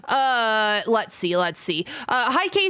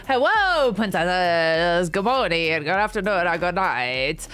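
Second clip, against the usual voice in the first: sing-song greeting; 3 semitones lower, slower than usual. Acted. Sing-song stream opening greeting.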